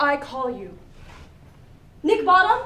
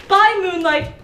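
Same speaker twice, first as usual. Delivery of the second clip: loud; 5 semitones higher, 5 dB louder than usual. Acted. Calling the company to rehearse.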